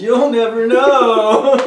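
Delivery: loud, high, slowly drawn-out taunt; teasing mystery. Pretend. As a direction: stretch it out as a hammed-up tease. Teasing the listeners about a secret.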